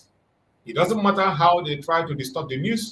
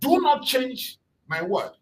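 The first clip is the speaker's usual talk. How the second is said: slow, deliberate warning, higher pitch; retold warning; 8 semitones higher, slower than usual. Man warning the Nigerian news agency, slow and firm. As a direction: spell it out slowly as a firm warning. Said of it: "The man warned the Nigerian news agency".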